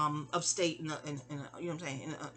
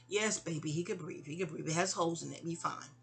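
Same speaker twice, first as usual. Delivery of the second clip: lower, softer soothing reply; calm the child down gently; reassuring mother; 4 semitones lower, 6 dB quieter than usual. Retold or imitated. Mother reassures the child about the rabbit, soft and low.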